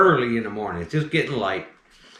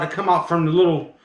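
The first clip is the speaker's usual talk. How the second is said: usual speaking voice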